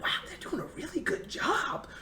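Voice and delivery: hushed, amazed whisper with a little laugh; awed whisper. Retold or imitated. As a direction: breathe it out in awe so no one nearby hears. Awed watching the drill from sidelines.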